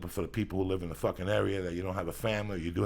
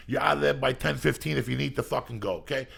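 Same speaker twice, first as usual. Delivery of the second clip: usual speaking voice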